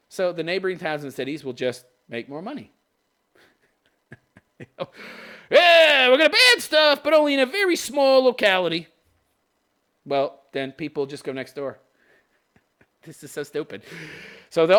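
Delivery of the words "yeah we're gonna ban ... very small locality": high, loud sarcastic cheer; mock enthusiasm. Commentator voicing the city banning stuff, mock enthusiasm. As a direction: crow it like a clueless campaigner. Mocking the city's ban.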